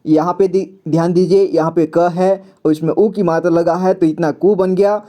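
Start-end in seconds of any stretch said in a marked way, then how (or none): none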